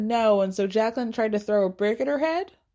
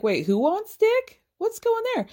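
intrigued surprise; 11 semitones higher than usual. Retold or imitated. Friend butting in on the argument, intrigued surprise. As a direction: voice leaps up in mock nosy disbelief. Acts out Candace's nosy surprise.

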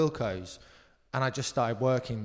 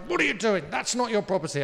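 mild mock rage; 7 semitones higher, 3 dB louder than usual. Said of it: "puce with rage".

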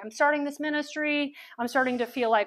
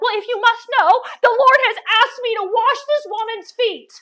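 high, loud mock-indignant announcement; exasperated retort; 9 semitones higher, 8 dB louder than usual. Speaker answers the irritated Marthas, loud exasperated retort. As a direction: proclaim it to shut down their questions.

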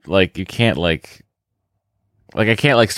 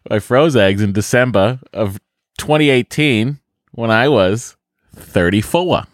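put-on Boston accent; 3 semitones higher than usual. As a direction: read it like a broad Bostonian. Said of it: "the accent from my, uh, our home".

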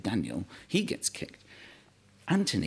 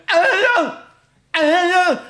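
high, loud garbled yell; changing, frozen voice; 10 dB louder, much slower than usual. Retold or imitated. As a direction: shout the name through a numbed, frozen mouth. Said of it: "you can hear his voice changing".

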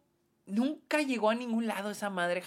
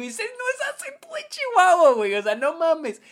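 loud, high exasperated protest; indignant outburst; 11 semitones higher, 9 dB louder than usual. Retold or imitated. Reviewer scoffing at the film's fake Chihuahua accent, loud and incredulous. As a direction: blurt it out like you can't believe the movie got it wrong. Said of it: "Y yo digo".

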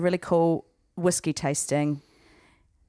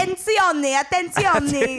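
put-on Italian accent; 9 semitones higher, slower than usual. Continuing mock Italian bit.

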